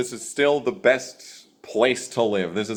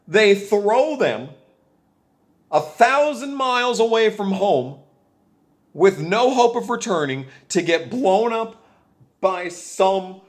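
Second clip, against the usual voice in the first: rising angry rant; 6 semitones higher than usual. Real, not acted. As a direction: pitch climbing higher line by line. Rant building in listing.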